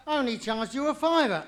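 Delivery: mock-outraged grievance; indignant protest. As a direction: object as if badly wronged. Defendant protests the harsher sentence.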